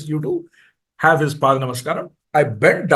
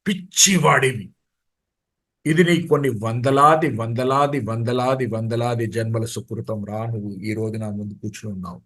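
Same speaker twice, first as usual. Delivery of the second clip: fast, fading lower and softer; fond scolding guru; 6 semitones lower, 3 dB quieter, much faster than usual. Retold or imitated. Swami telling the devotee his birth is a fortune, fading murmur. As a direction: rattle it off, dropping away to a near murmur.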